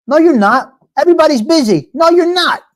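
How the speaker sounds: high, rising exasperated contradiction; exasperated rebuttal. Real, not acted. Arguing with people who say call me, exasperated. Argues with empty offers of help.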